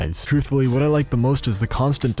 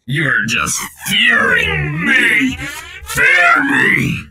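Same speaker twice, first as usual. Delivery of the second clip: much higher, louder, warped flange-effect menace; flanged demon voice; 4 dB louder, much slower than usual. Shown as a demonstration. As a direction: roar a mock threat through heavy flange. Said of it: "So if I add this".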